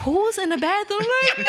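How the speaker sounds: high laughing mock call; laughing quote. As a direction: squeal it through laughter. Coworkers asking, retold laughing.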